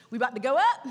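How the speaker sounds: excited laugh as the sermon lifts off; about to take off, laughing; laughing, revving up. Real, not acted. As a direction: chuckle and climb. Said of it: "helicopter moment for preachers".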